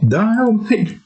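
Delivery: high sing-song summoning call; eerie calling voice. Retold or imitated. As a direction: call him back from the coma. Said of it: "the thing goes".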